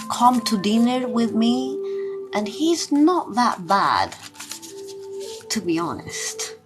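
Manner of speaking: normal talking voice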